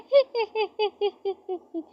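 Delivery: high mocking titter; mocking laugh. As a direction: titter at her expense. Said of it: "उस पर हंसती है".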